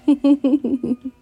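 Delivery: high playful giggle; flirty giggle. Acted. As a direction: giggle teasingly. Giggle during striptease.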